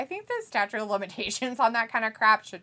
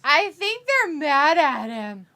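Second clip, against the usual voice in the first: slow, higher, louder insistence; emphatic repeat; 5 semitones higher, 7 dB louder, slower than usual. Real, not acted. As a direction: stretch it out loudly so it lands.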